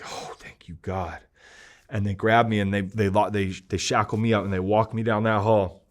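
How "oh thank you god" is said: a soft, breathy whispered prayer of relief; whispered thanks. Real, not acted. Rescued from the cell, whispering thanks to God. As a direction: whisper your thanks to God, half to yourself.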